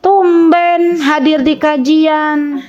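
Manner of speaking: drawn-out, needling "tumben"; mock surprised dig; snide greeting. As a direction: imitate a catty friend, stretching the words slowly. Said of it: "Yang ada lisannya bilang gini".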